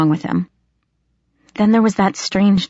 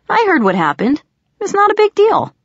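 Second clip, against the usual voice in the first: higher, breezy reassurance; light boyish voice; 14 semitones higher, 3 dB louder than usual. Acted. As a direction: brush it off gently. Robbie downplaying it.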